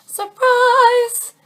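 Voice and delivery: high, loud, drawn-out reveal; playful ta-da, held high; singsong surprise. Acted. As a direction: sing it out like a surprise announcement. Voices the long-awaited surprise arriving.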